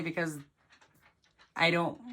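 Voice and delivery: usual speaking voice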